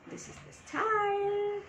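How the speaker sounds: high and drawn out very slowly; sing-song show-off. Pretend. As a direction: stretch every word like a showpiece. Showing off the style. (উঁচু সুরে টেনে টেনে বলা)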